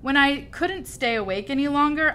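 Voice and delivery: plain reading voice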